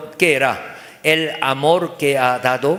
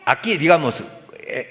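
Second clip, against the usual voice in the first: normal talking voice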